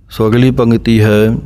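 ordinary talk, nothing marked

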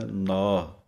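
ਨਾ with its kanna vowel drawn long. Shown as a demonstration. Teacher demonstrating the long aa in ਨਾ. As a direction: stretch the aa in ਨਾ. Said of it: "ਇਹਦੀ ਆਵਾਜ਼ ਲੰਮੀ ਦੇਣੀ ਹੈ".

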